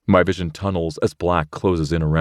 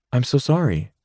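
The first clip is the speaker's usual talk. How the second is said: put-on lightly higher girl's voice, a touch of regret; Rachel apologising; 6 semitones higher than usual. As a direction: say sorry to the shaken boyfriend.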